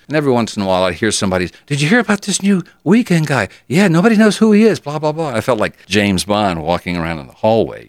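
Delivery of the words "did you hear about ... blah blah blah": high excited chatter; lightly voiced teen gossip; gossipy classmate. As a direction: gossip about the mystery DJ.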